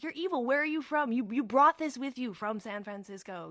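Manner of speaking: loud, high mock accusation; imitated screaming. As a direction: hurl the accusation like a hysterical townsperson. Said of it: "they scream at her".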